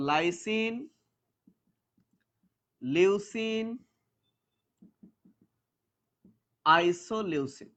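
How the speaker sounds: each name drawn out slowly, one by one; slow recital. Shown as a demonstration. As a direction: spell out ভ্যালিন-style names slowly for the class. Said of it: "এরপরে তিনটা আমরা একসাথে পড়ব". (ধীরে ধীরে নাম উচ্চারণ)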